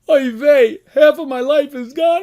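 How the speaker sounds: loud, high, hammed-up lament; mock wailing. Rabbi acting out the sage's lament to God, mock wailing. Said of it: "He starts crying".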